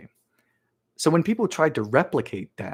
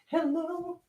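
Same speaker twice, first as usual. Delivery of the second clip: soft, high falsetto hello; singsong hello; 6 dB quieter than usual. Acted. Host calling for the missing salt, high singsong. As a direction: call out softly in falsetto.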